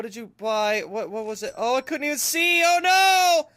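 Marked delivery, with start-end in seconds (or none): 1.5-3.5 high, rising cry of dismay; dismayed wail. Real, not acted. Shop owner misses a customer's purchase, dismayed wail. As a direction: let it climb into a loud 'no'.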